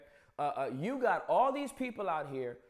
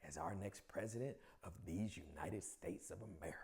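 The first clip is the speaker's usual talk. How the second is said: whisper; 11 semitones lower, 15 dB quieter, faster than usual. Real, not acted. Joking aside on [name].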